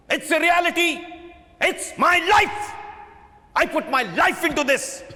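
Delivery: beside himself, roaring back at the heckler; booming rage. Acted. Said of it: "He boomed".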